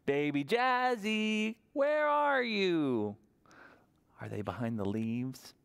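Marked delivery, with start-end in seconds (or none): 0.0-3.1 high, slow drawn-out call; mother calling. Acted. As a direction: call out for the hiding baby, stretched. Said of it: "Mama Jojo is going to seek".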